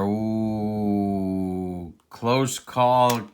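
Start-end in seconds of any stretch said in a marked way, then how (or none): 0.0-1.9 long, low "oooh"; sustained ooh at a near miss; drawn-out "ooh". Real, not acted. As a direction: let out a lingering low ooh. Reaction to a close call.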